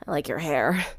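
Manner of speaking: put-on sick girl croaking a smile; hoarse, worn-out whisper-croak. A narrator's reading.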